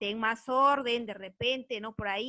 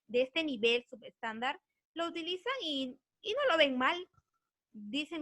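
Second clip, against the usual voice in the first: ordinary delivery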